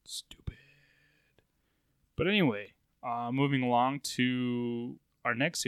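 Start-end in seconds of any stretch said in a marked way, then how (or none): 0.0-0.5 unvoiced grumble under the breath, much softer; hushed, whispered mutter; whispered aside. Real, not acted. Muttered aside about card legality.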